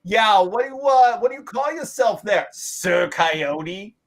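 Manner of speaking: high, loud jeering call; distant taunting shout. Acted. Young coyotes make fun of a young man, taunting shout. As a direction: heckle him from across the road. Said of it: "they shout out from the distance".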